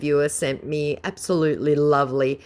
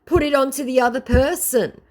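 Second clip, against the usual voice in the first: high, loud sassy retort; mock viewer voice; 11 semitones higher, 4 dB louder than usual. Vlogger voicing the viewer's retort, high and loud. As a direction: fire it back high and loud. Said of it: "she goes".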